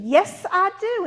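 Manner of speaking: high, loud, quick-to-please reply; eager answer. Acted. Mother answering the queen, high eager yes. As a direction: pipe up eagerly.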